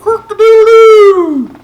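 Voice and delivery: very high, very loud cock-a-doodle-doo; loud rooster crow. A narrator's reading. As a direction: crow at full volume like a rooster. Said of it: "the cock crowed".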